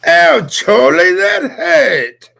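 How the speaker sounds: high-pitched English child impression; loud, slow squeal in an English accent. Friend topping the English hello with a quote, squealed English accent.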